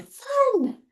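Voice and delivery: high-pitched, drawn-out mock scream; playful all-caps scream. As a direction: squeal it out high, like shouting in capitals. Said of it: "maybe in all caps they're screaming".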